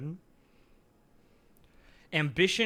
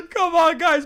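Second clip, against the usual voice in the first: high laughing coax, louder; laughing and pleading, pitched up; laughing plea; 12 semitones higher, 9 dB louder than usual. Real, not acted. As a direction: giggle out the urging. Laughing, urging chat.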